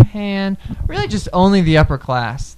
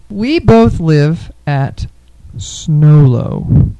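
slow; 7 dB louder, much slower than usual. Acted. Slow mock-mispronounced name.

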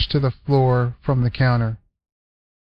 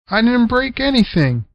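put-on boy protesting; 10 semitones higher than usual. Cliff protesting.

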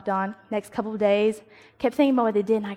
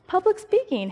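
eager; 9 semitones higher than usual. Retold or imitated. Retold eager self-quote.

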